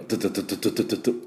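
fast staccato トゥトゥトゥ like a jackhammer; imitating a construction drill; drill sound. Shown as a demonstration. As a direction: rattle off トゥ in short hard bursts. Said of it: "マシンガン、または工事現場の、えー、ドリルの".

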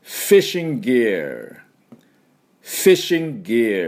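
"fishing gear" said the English way; English pronunciation. Shown as a demonstration. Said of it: "私の後で発音いきましょう".

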